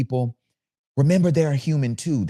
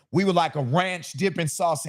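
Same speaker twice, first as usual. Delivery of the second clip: projecting; 5 semitones higher, 5 dB quieter than usual. Shown as a demonstration. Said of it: "And so now I'm projecting".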